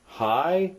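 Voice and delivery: "Hi" pitched high, rising like a tone. Shown as a demonstration. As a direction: lift "Hi" upward as in a tonal language. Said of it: "it probably was a rising sound".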